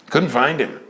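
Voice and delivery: normal talking voice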